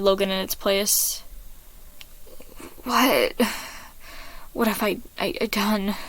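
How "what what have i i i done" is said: softer, stammering horror; panicked mumble. Acted. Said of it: "Logan mumbled, panic".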